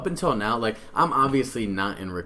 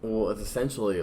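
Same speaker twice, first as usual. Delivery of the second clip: ordinary delivery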